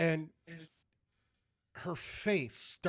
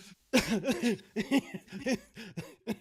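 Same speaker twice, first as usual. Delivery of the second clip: put-on old woman's belly laugh; 8 semitones higher, 4 dB louder than usual. The old priestess finally gives in and bursts out laughing. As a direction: let a long helpless laugh roll out. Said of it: "she's like".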